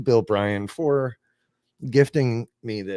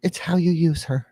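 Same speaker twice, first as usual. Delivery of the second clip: put-on little girl voice; 8 semitones higher than usual. Puppet Annabelle voice.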